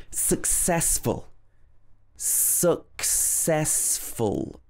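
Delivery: successful, slow, ses stressed. Shown as a demonstration. Teacher modelling stress for learners, slow. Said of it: "stressing the ses".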